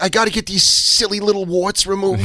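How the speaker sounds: imitating Saul. Saul calling about his warts. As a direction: whine it like a fussy old man. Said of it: "be like".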